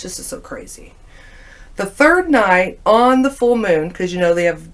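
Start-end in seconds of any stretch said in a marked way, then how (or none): 0.0-0.9 unvoiced whisper to herself. Alone after the dream, whispering to herself in awe. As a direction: breathe it out in awe.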